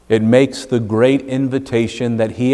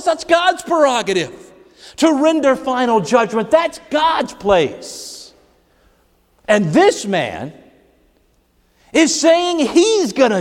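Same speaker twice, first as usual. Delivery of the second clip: high, driving preacher's voice, pressing each phrase home; raised, urgent sermon delivery; full-force preaching. Acted. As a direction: preach it hard to the congregation.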